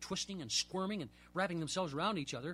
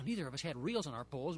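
ordinary delivery, nothing marked

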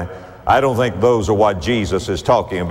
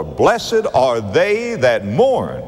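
each word slow and weighed; slow it right down, let each word land; slow emphatic reading; much slower than usual. Shown as a demonstration. Preacher urging notice of verse four, slow and weighty. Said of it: "notice especially now verse four".

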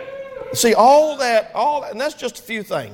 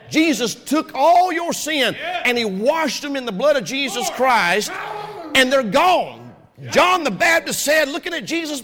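full-force preaching; 7 semitones higher than usual. Acted. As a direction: preach it up to the rafters.

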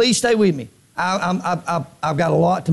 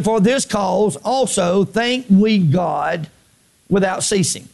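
very slow, measured scripture; drawn-out, deliberate Bible reading; much slower than usual. Real, not acted. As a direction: read the verse word by word. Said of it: "I'm gonna read it slow".

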